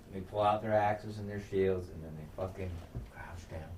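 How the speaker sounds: usual speaking voice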